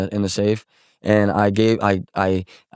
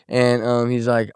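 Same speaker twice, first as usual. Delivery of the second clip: ordinary talk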